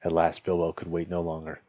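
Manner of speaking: plain reading voice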